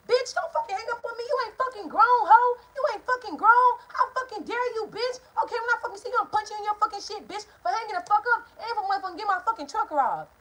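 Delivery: high, shrill-pitched threatening yell as mom; imitating mom's high, loud tirade; furious mother. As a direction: scream threats at your grown kid like a raging mother. Mom scolds her for hanging up.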